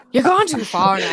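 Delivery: put-on laughing through a light Irish accent; hint of Irish lilt while giggling; half-laughing fake Irish accent; mock Irish accent. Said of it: "That was my best Irish accent".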